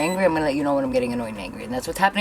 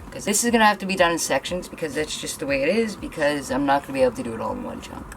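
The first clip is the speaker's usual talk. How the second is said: normal talking voice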